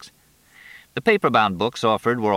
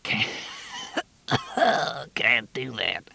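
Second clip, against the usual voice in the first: speaking through a cough; words broken by coughing; 6 semitones higher, 4 dB quieter, slower than usual. Acted. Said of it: "Marie coughed".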